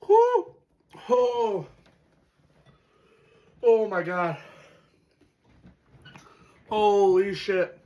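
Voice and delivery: loud gasping cries as the heat hits; pained shout. Real, not acted. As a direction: yell it out, mouth on fire. Spice fully hits him.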